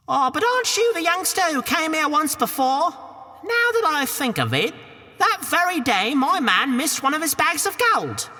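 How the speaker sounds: put-on high Cockney woman shooing the boy; a high, brash Cockney housewife; Cockney ogre's wife. Wife shoos Jack from the castle door, high Cockney.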